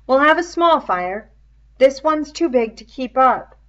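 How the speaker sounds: lightly voiced schoolboy taking charge; boyish, practical, decisive; Ralph's voice. Ralph's dialogue in a reading.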